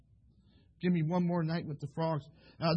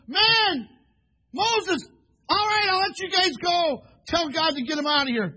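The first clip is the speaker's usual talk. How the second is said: Pharaoh; 11 semitones higher, 9 dB louder, slower than usual. Acted. Acts Pharaoh giving in to Moses.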